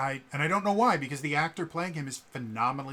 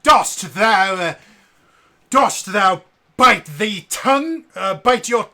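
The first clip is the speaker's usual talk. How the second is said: booming, high-pitched ham Shakespeare; bad Shakespearean actor; 9 semitones higher, 18 dB louder, slower than usual. Mocking bad British voice acting, hammed-up Shakespearean delivery. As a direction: declaim it loudly like bad Shakespeare in the Park. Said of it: "really bad Shakespeare in the Park".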